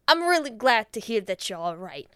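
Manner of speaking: punched-out gangster phone line; imitating a tough guy, forcefully; Joe Pesci. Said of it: "I imagine Joe Pesci".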